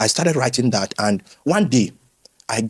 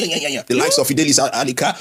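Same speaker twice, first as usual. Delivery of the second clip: normal talking voice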